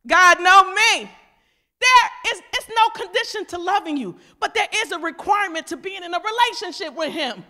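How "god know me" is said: loud, drawn-out acted boast; loud mock insistence. Unrepentant believer insisting on a free pass, loud mock boast. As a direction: insist on it loudly and slowly. Same excuse repeated, now loud.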